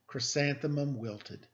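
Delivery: ordinary delivery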